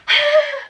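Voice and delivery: a quick bright burst of laughter; short high laugh. Real, not acted. Admitting to viewers she never gets things done, short laugh. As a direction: laugh at yourself. Laughs at herself being a flop.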